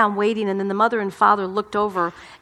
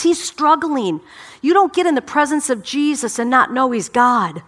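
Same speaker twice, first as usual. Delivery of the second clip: usual speaking voice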